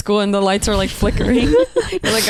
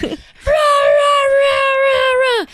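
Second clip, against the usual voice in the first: mock angry yelling; much slower than usual. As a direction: play the two of you yelling in a heated fight. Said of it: "we're like".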